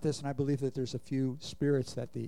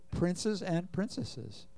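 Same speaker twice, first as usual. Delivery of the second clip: "princes and princesses" drawn out slowly; slow emphasis; 2 semitones higher, 4 dB quieter, much slower than usual. Shown as a demonstration. Preacher telling believers who they are, slow emphasis. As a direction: spell out "princes and princesses" word by word. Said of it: "repeats the declaration slowly".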